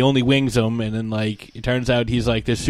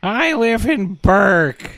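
squeaky-voiced whiny teen, slow and high; mock a whiny, nasally teenager, loudly; Hiccup; 8 semitones higher, 4 dB louder, slower than usual. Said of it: "impression of the squeaky voice teen".

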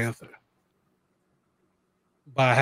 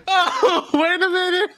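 high-pitched, laughing imitation of surprise; mock dawning realisation; 4 dB louder than usual. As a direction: act out the late click through giggles. Quotes own late realisation while laughing.

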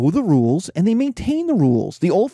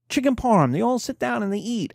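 ordinary talk

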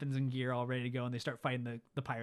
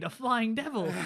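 higher, louder villain flourish; dramatic Hook; 8 semitones higher, 6 dB louder, slower than usual. Retold or imitated. Hook describing Peter, higher and louder. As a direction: ham up the villain's outrage. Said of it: "He's like".

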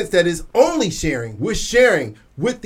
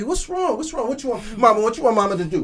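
put-on cooing mother to baby. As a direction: coo and soothe like a mom with a crying baby. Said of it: "You talking to the baby".

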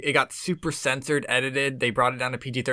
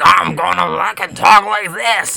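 higher, louder, slow put-on monster voice; mock Venom voice; 6 semitones higher, 11 dB louder, slower than usual. Mocking the trailer's Venom voice to friends, slow and loud. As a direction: ham up the film's Venom voice to mock it. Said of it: "his voice is like".

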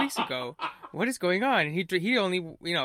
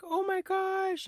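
much higher, slow, breathless; stunned gasp; 3 dB quieter, much slower than usual. Retold or imitated. Fiancée seeing the proposal sign, stunned and high. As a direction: let the shock stretch out, high and slow. Fiancée sees her name on marquee.

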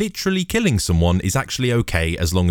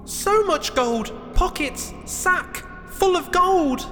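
very high falsetto, spaced-out words; small-voiced hero calling out; mock shouting; much slower than usual. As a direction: shout it down piece by piece, squeakily. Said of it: "shouted Jack".

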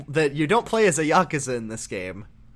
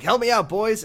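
higher, louder plea for help; Kuze pleading; 7 semitones higher, 4 dB louder than usual. Retold or imitated. Kuze begging his boys, raised and pitched up. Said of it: "Kuze's like".

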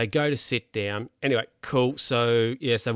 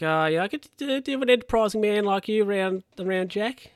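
higher, smarmy flattering voice; butter him up in a higher put-on voice; imitating Bernie; 10 semitones higher than usual. Bernie offering Jack a job, higher smarmy voice.

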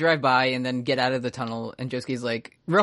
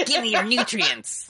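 hammed-up creepy stand voice; 7 semitones higher than usual. Voicing the stand.

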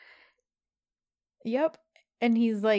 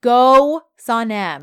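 cheering; 3 semitones higher, 9 dB louder, much slower than usual. Real, not acted. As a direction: cheer her on, loud.

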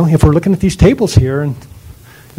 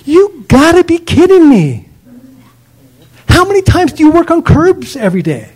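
pitched-up outraged disbelief; indignant rant; 8 semitones higher than usual. Real, not acted. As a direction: rant from the high horse, incredulous. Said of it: "while I'm on my high horse".

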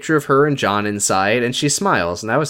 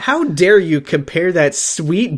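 high, slow theatrical scolding; drawn-out, hammed-up indignation; mock indignation; 5 semitones higher, slower than usual. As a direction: act out an outraged reproach. Acting out Lauren's scolding.

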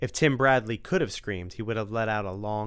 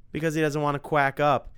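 gleeful punchline; 6 semitones higher, 6 dB louder than usual. Acted. Tim delivering punchline.

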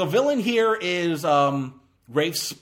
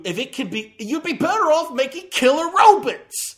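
high, loud incredulous rant; really exasperated, pushing the point; 10 semitones higher, 5 dB louder than usual. Real, not acted. Exasperated rant about weaponizing dinosaurs.

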